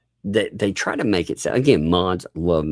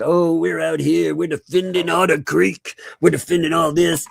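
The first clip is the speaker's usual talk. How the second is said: higher-pitched mock of indignant defenders; mocking imitation; 9 semitones higher, 3 dB louder than usual. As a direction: imitate them in a high, put-on voice. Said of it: "I love it when they mock Southerners".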